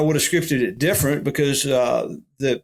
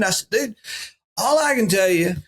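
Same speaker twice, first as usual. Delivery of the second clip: ordinary talk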